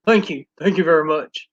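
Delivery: low rock-and-roll idol impersonation; playful low-voiced celebrity impression. Shown as a demonstration. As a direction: drop the voice into a crooner's thank-you. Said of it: "That's my [name]. That's all I say".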